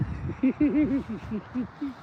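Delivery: long high-pitched giggle; helpless high laughter; real giggling. Real, not acted. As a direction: giggle on and on, unable to finish. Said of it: "I'm laughing because it is".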